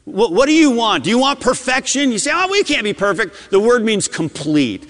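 ordinary delivery

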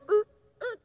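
high, small imitated infant squeaks; tiny high mock whimper; baby squeak. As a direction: mimic a fussing baby's little squeaks. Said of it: "hear 'em squeak a little bit".

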